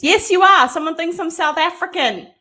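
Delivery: loud, high call to someone off-camera. Real, not acted. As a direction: raise your voice teasingly at your husband. Calling back to husband off-camera.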